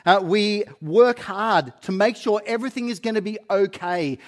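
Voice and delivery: ordinary talk, nothing marked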